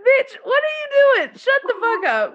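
squeaky high fast mock shriek. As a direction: shriek it like a cartoon diva.

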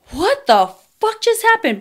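high-pitched baffled question; 1 stunned disbelief. Retold or imitated. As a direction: ask it high and stunned, as if reliving it. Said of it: "And I'm like".

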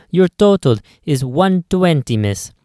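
friendly Italian shopkeeper; lightly Italian clerk voice; Italian accent. Acted. Clerk voice held.

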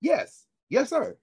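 mock-obedient yes to the boss; deferential. Official answering the CEO's call, deferential.

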